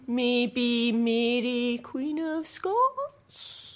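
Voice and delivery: slow, drawn-out questioning guess; hesitant quiz answer rising at the end; tentative guess. Real, not acted. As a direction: stretch it out, unsure of the answer.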